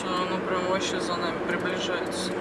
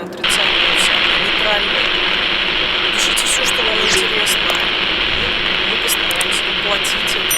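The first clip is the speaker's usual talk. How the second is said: loud sign-off; 12 dB louder than usual. Real, not acted.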